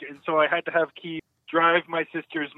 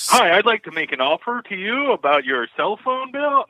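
telemarketer; 6 semitones higher than usual. Pretend. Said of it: "we should all just whisper".

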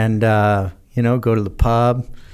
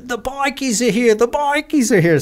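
alarmed Aussie shout; 12 semitones higher than usual. Retold or imitated. Crowd shouting bikers arrived.

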